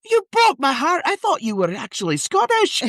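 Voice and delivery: very high, wounded Scottish mum; hammed-up high-pitched Scottish reproach; Scottish mother. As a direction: wail in mock heartbreak with a Scottish accent. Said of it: "She's like".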